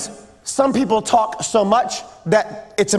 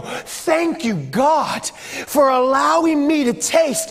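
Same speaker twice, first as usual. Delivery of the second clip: higher, building heartfelt thanksgiving; fervent prayer of thanks; 4 semitones higher than usual. Acted. Preacher models thanking God for everyday gifts, fervent. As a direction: swell to a ringing, drawn-out finish. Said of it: "slow down enough to go".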